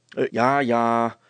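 loud, grudging call; grumpy. A narrator's reading. Brilstra answers his wife at the door, grumpily. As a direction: answer back with irritation. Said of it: "riep die knorrig".

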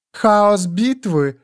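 slow, sultry purr. A narrator's reading. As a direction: purr it out like a seductive lizard. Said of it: "мурлыкнула Телораст". (мурлыкающий соблазнительный тон)

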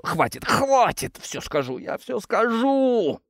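high, drawn-out cries of surrender; desperate pleading. A narrator's reading. Tortured worker begs torturers to stop, desperate wail. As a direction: beg in agony, wailing the words.

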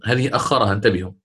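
normal talking voice

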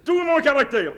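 proud delight. Acted. Father recognises himself in her.

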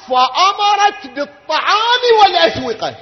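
high, loud drawn-out proclamation; fervent preaching. Acted. Preacher telling mourners of the meal, loud chant. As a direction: proclaim it to the hall.

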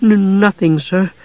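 high, timid stutter; nervous girl tripping over her reply; stammering. A narrator's reading. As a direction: stumble on the first sound, meekly. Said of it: "Usha stammered".

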